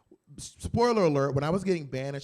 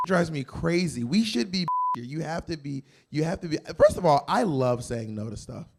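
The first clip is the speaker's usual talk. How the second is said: ordinary talk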